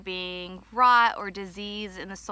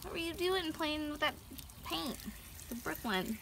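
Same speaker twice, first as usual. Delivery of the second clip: squeaky praising a dog; 9 semitones higher, 10 dB quieter than usual. Acted. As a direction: coo at the dog like a baby. Soothing the dog with water.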